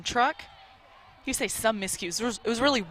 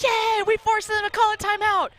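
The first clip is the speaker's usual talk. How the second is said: much higher, gleeful mock cheer; cheering teammate; 12 semitones higher, 4 dB louder than usual. Teammate in the huddle cheering, mock excited. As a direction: celebrate a timeout you wrongly think you forced. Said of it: "someone says".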